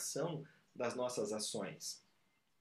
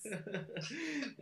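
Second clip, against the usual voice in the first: short laugh; 9 semitones higher than usual. Real, not acted. Laughs at the filosóficas joke.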